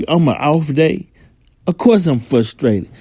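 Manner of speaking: usual speaking voice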